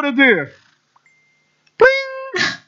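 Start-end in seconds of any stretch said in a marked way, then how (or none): 1.8-2.5 high, held ringing note; ping it out high and sustained; imitated pling. Preacher telling of concert crowds, high-pitched pling. Imitates a sound mid-story.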